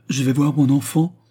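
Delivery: high, loud excited question; leaping with excitement; astonished cry. A narrator's reading. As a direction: exclaim it in joyful disbelief. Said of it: "s'écria Beausire en bondissant comme un convulsionnaire".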